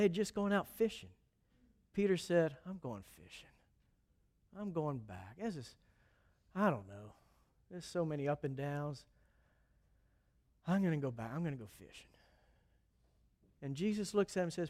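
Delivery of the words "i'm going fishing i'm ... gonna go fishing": low, soft, worn-out voice; weary giving up. Acted. Peter tells his friends he is quitting, low and weary. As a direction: sound like a man who has given up.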